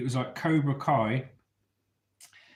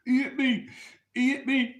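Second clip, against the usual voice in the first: put-on high, wailing little-boy whine; imitating crying kid; 13 semitones higher, 4 dB louder than usual. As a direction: whine it like a hurt child. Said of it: "walking off crying".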